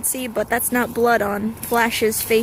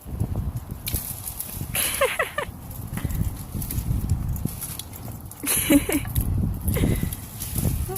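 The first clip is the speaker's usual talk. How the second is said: airy laugh; 8 semitones higher, 4 dB quieter than usual. Real, not acted. Owner laughing at dogs.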